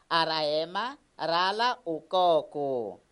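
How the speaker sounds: put-on slow, clear sentence for children to repeat; slow model reading. Shown as a demonstration. Teacher reads slowly so pupils repeat. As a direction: read it slowly, syllable by syllable. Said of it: "vamos repetir comigo algumas frases".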